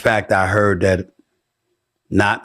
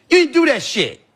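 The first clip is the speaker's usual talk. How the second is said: ordinary talk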